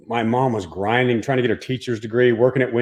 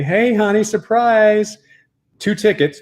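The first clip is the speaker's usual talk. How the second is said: excited mom; 10 semitones higher, slower than usual. Retold or imitated. Mom surprising son with tickets.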